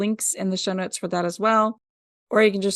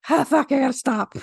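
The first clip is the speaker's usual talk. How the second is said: gasping after a breathless intro; winded, stopping to gasp; out of breath; 3 semitones higher than usual. Real, not acted. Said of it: "I'm running out of breath".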